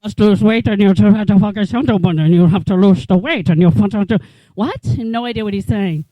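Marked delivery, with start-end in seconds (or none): 0.0-4.2 put-on loud, garbled foreign-accent doctor rant; Doctor Now's weird accent. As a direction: mash the words like the doctor with his weird accent. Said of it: "the weirdest accent and the weirdest voice".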